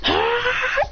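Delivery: drawn-out, high-pitched cry; high yell. Acted. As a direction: let out a high battle yell. Acted cry in the fight.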